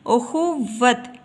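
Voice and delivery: उखुवत slowly, the v held double; doubled consonant. Shown as a demonstration. Teacher shows the shad to learners, उखुवत slow with doubled v. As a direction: split उखुवत so the waw sounds twice. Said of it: "इसकी आवाज़ दो बार बोली जाएगी".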